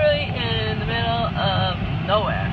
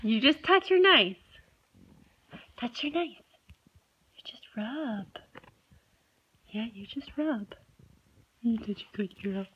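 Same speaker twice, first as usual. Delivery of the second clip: hushed gentle coaching; soft coaxing; 5 dB quieter than usual. Real, not acted. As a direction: coax her softly.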